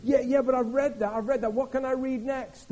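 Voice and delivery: high, loud, fast eager questions; eager new believer. Retold or imitated. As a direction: gush like a keen new convert.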